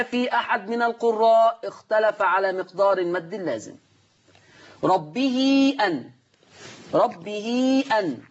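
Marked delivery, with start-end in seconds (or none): 4.8-8.2 slow Quranic recitation of ربه أن, vowel drawn out; recited madd. Shown as a demonstration. Teacher to students, slow drawn-out recitation of ربه أن. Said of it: "مد إيه؟".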